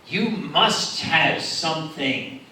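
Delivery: very slow, stretched oratory; mock-grand appeal. Urging local fellows to tell untold stories, slow grand appeal. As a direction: draw out each phrase grandly. Coaxing a story with mock gravity.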